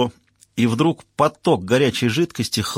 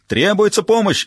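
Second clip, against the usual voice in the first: much higher, louder call; shouting for help; 9 semitones higher, 4 dB louder than usual. A narrator's reading. Judge shouting for help during the fight. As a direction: call out over the brawl. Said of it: "закричал судья".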